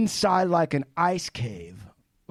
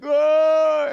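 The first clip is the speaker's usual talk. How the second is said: high, loud strained yell for help; mock yell; 12 semitones higher, 7 dB louder than usual. Stranded climber yelling to his buddy, loud mock yell. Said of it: "he's trying to yell".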